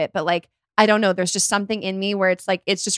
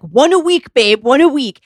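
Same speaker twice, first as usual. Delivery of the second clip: louder, insistent scolding of a partner; berating; 5 semitones higher, 7 dB louder than usual. Retold or imitated. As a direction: hammer it out like a nagging reprimand. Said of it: "I was just being berated".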